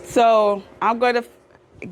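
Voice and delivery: normal talking voice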